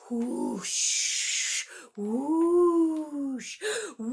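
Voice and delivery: breathy blowing whoosh; wind whoosh. Shown as a demonstration. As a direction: blow like the wind. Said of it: "Can you sound like the wind".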